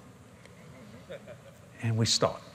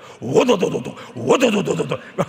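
laughing rattle of a revving motorbike; mock a motorcycle revving through a laugh; imitated motorbike roar; motorcycle engine imitation; 2 semitones higher, 4 dB louder than usual. Shook up that hotel.

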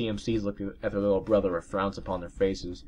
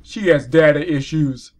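joking aside; 6 semitones higher, 11 dB louder, slower than usual. Real, not acted.

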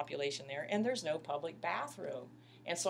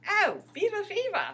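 high, loud attempt at Canadian accent; imitated Canadian accent; 9 dB louder than usual. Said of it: "in his great Canadian accent".

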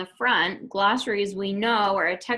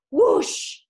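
louder whooshing splash sound; 6 semitones higher, 7 dB louder than usual. Acted. Coyote lunges into water.

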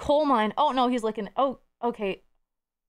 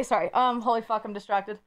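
usual speaking voice

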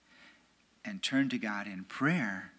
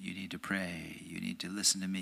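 God's voice; 4 semitones lower, 6 dB quieter, faster than usual. Retold or imitated. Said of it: "It's like God saying".

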